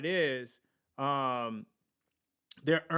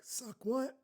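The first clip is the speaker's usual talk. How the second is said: softer, much higher quizzical question; quiet, high-pitched puzzled movie quote; Godfather parody; 8 semitones higher, 6 dB quieter than usual. Retold or imitated. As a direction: lightly mimic a movie mobster, baffled and rising. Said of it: "acting like the Godfather".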